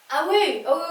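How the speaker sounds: usual speaking voice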